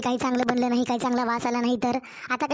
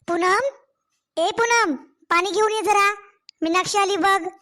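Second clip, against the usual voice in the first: raised, higher call across the house; 5 semitones higher than usual. Acted. Mother calling daughter-in-law Poonam from another room, raised call. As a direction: shout her name so she hears from the kitchen.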